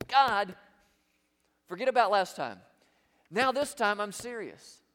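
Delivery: loud, emphatic mock prayer, broken by pauses; hammed-up earnest bargaining, punched loud; insistent acted plea. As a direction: call out to God like a repeat offender making promises. Acted repeat prayer of backsliding sinner.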